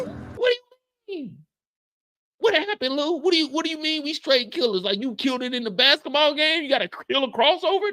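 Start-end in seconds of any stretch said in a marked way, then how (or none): 0.0-4.8 high baffled question. Acted. Confused neighbor questioning Lou.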